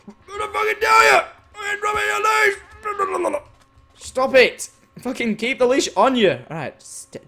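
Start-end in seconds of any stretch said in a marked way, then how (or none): 0.1-3.6 very high, loud ranting shout; furious screech. Pretend. Player fed up with horse losing leash, furious screech. 4.0-6.4 loud, high exasperated order; angry scolding. Real, not acted. Player tells horse to keep leash on, angry scolding.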